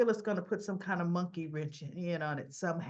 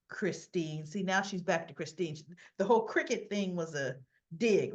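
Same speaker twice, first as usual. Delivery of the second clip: normal talking voice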